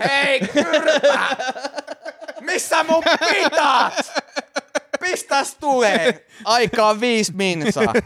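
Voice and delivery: very high, slow mock yell with laughter; rude shouting customer. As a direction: ham up a rude pita demand. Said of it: "teillä siellä se on heti siellä". (pilkallinen huutava asiakas, hidas ja korkea)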